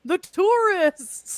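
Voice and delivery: loud, high, drawn-out mock mayor alarm; hammed-up mayor wailing over lost tourists; Mayor from Jaws. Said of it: "he's giving very much Mayor from Jaws".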